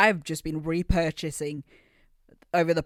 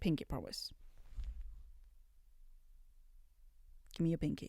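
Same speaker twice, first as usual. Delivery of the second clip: quiet aside; 3 semitones lower, 16 dB quieter, much faster than usual. Real, not acted. Soft aside to camera.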